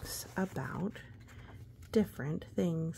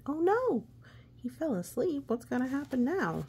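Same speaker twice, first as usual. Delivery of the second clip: alarmed; 8 semitones higher than usual. Acted. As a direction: gasp at the danger for the kids. Reacting to knight asleep.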